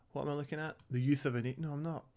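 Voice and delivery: normal talking voice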